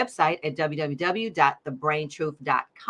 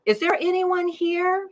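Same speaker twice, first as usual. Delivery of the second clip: much higher, calling out; cried a little louder; 11 semitones higher than usual. A narrator's reading. Brain cell calling louder into the dark, high cry. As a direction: call out, a bit anxious. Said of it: "she cried a little louder".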